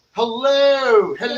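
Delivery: a light, mock British "Hello."; weird British accent. Host greeting the guests in a mock British accent. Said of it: "In a weird British accent".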